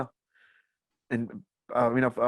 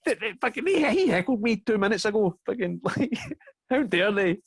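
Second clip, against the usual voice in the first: mock outrage; 9 semitones higher than usual. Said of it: "sit there like".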